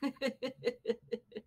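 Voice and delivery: high, soft amused laugh. Real, not acted. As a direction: laugh at the absurdity. Laughs at the drama.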